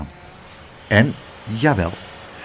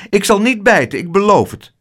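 slightly rushed, higher-pitched promise; higher voice, a touch of haste; hasty promise; 6 semitones higher, 3 dB louder than usual. A narrator's reading. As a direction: blurt out an eager promise. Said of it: "zei Spijterbijt haastig".

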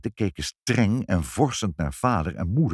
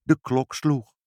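ordinary delivery

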